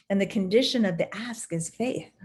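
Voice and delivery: ordinary delivery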